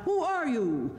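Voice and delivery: high-pitched challenge; crying out. A narrator's reading. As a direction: call it out sharply, high at the start. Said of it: "cried out".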